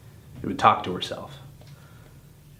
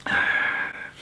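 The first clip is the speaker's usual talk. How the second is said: long, voiceless sigh of grief; heavy sigh. Acted. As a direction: let out a heavy breath. Grieving after telling of stillbirth.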